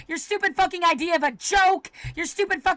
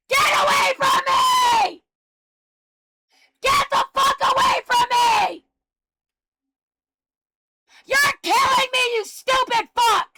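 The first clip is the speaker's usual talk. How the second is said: furious screaming; 6 semitones higher than usual. Real, not acted. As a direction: scream them away from you, real rage. Screaming at people harassing him.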